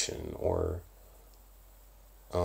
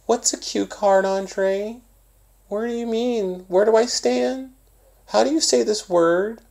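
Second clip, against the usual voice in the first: put-on much higher, small childish voice, asking lost questions; imitating a little kid; 4 dB louder than usual. Acting teacher mimics a kid student in class, high childish voice. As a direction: squeak it out like a confused young student. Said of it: "and then there's the babies".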